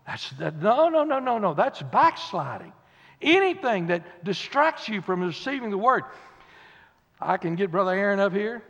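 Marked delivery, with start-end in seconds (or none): none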